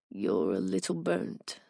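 low, soft British "burnt"; British accent. A narrator's reading. Owen teasing the narrator in a soft British accent. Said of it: "inflection of a British accent".

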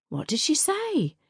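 high, eager gossipy postmistress; eager high gossip. Acted. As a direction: lean in, hungry for gossip.

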